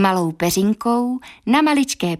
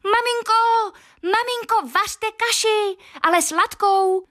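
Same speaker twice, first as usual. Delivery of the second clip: put-on very high little boy's cry; high tiny voice calling mother; tiny Thumbling shouting; 14 semitones higher, slower than usual. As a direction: squeak it like a thumb-sized boy. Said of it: "křičel z plna hrdla".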